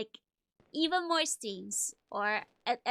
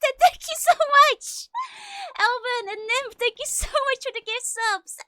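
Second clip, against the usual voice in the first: high, loud joyful thanks; excited thanks; 11 semitones higher, 10 dB louder than usual. Real, not acted. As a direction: shout thanks, thrilled. Thanking gift subs at midnight.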